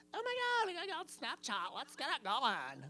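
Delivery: squealing imitation of a student; Emma voice. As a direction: gush like a hyped-up teenager. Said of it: "that's my Emma voice".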